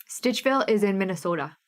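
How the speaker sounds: usual speaking voice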